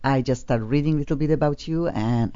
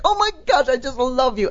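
excited greeting; 9 semitones higher than usual. Retold or imitated. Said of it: "and we are like".

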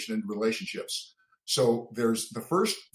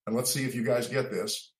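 normal talking voice

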